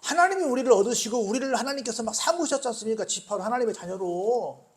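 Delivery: normal talking voice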